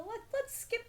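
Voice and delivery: lightly voiced naive younger self; mock inner voice. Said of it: "I decided".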